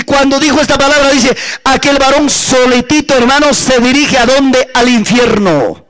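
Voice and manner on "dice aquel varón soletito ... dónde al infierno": ordinary talk, nothing marked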